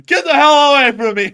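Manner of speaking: high, loud playful shout; mock panicked yell. Joking with co-host about being scared, mock panicked yell.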